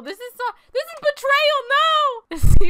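high protest building to a loud no; outraged wail. Acted. Player watches pet human defect, outraged wail.

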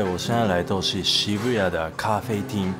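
normal talking voice

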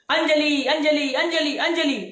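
mock calling out for a lost love; madman calling out. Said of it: "पहला पागल चिल्ला रहा था". (पागल की तरह ऊँची आवाज़ में पुकारना)

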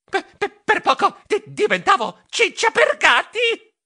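a shaken stammer after the shark. Acted. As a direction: stutter breathlessly on per, per. (balbettando spaventato)

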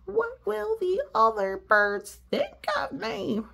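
high, fretful bird voice; worried cartoon pigeon asking. Adult voices Pigeon in story.

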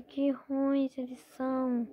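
normal talking voice

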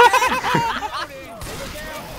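long squealing laugh; high-pitched laughter. Real, not acted. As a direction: burst into high, helpless laughter. Laughing after getting caught.

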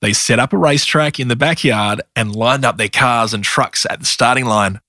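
ordinary delivery, nothing marked

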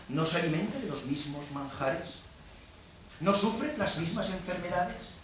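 soft, restrained rhetorical questions; subdued pleading. Acted. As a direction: plead quietly for common humanity. Shylock pleads shared humanity.

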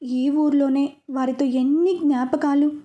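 plain reading voice

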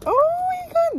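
high delighted gasp; excited squeal. Real, not acted. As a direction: squeal at something cute. Sees cute gift.

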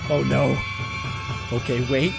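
ordinary talk

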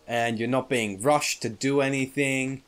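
usual speaking voice

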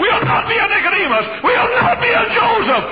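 very high, very loud rallying cry; preaching shout. Real, not acted. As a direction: call the church to stand with you. Final rallying call.

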